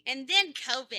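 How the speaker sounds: loud, mock-ominous aside; dramatic interjection. Real, not acted. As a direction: cut in with comic drama. Interjects comic dread into introduction.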